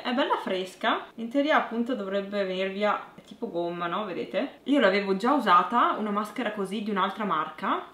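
lips stiff under drying mask; speaking with mask on face; through a face mask. Real, not acted.

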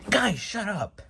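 high, loud annoyed yell; fed-up shout. Acted. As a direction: cut them off, exasperated. Fed up with the argument.

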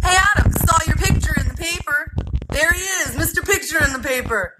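high, far-off teasing townsman voice; teasing neighbour. Acted. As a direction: rib the kid in a high mocking voice.

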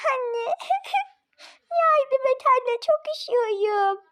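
put-on a small girl begging her mother to help; little girl pleading.

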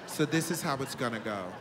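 calm and in total control; grown woman voice. Retold or imitated. Voicing the older woman.

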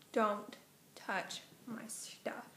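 retold warning, one slow word at a time; stern warning. Gallery owner warning visitors off her stuff, quietly stern.

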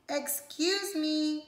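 a tiny creature clearing its throat; small cricket voice. Acted. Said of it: "coughed a cricket".

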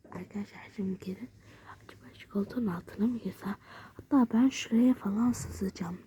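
quick hushed whisper; fast whisper. Real, not acted. Girl whispering quickly to viewers from her hiding spot. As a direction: rush the words in a hiding whisper.

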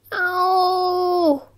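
high, sharp little yelp of pain; a small boy crying out; child's cry of pain. A narrator's reading. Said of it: "letting out a cry".

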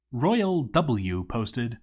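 ordinary delivery

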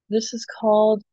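usual speaking voice

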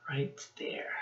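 soft unvoiced whisper of the answer; hushed, breathy stage whisper for children. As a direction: whisper it like a secret to the class. Teacher whispers the answer to kids.

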